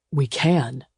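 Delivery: firm, understated insistence; emphatic. A narrator's reading. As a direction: state it with certainty. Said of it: "he said emphatically".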